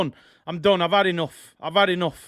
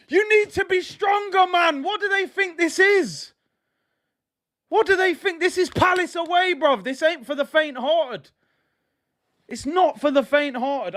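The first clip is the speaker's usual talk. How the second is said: high, agitated scolding of the team; worked-up rant; 6 semitones higher than usual. Real, not acted. Fan berating his team at Palace away, worked-up and high. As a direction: demand more fight, pitch riding high. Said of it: "I need to calm down".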